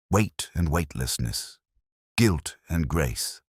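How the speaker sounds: ordinary talk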